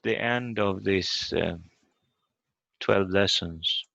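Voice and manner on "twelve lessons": ordinary talk, nothing marked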